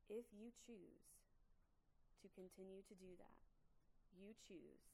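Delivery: deliberate and controlled, pausing between phrases to lay down a choice; intense, not yelling. Shown as a demonstration. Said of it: "you can hear the intensity in my voice, but I am not yelling".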